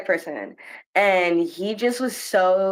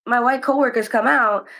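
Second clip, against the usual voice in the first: ordinary delivery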